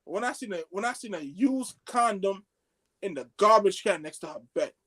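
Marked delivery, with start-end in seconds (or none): none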